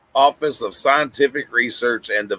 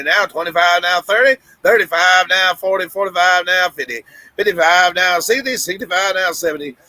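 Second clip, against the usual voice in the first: rapid auctioneer bid calling; 6 semitones higher than usual. Acted. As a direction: rattle off bids like an auctioneer. Auction chant for a bidder.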